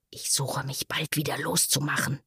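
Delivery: leise, heimlich vor sich hin flüstern; geflüstert. A narrator's reading. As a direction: ein hastiger, geheimer Gedanke im Flüsterton. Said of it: "dachte in seinem Sinn". (flüsternd, fast tonlos)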